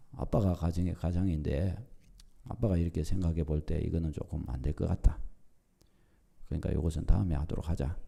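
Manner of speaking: low, soft, measured fatherly verdict; father's calm ruling. Retold or imitated. Father seats wife and two daughters to decide, low and calm. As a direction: quietly lay down the decision as head of the house.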